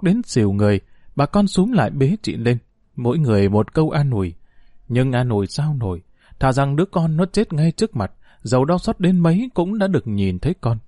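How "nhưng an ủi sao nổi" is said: ordinary delivery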